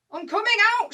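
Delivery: high, loud announcement; shout. Acted. German announcing himself to the British, high shout. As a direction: call it out across the trenches. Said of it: "shouts this German".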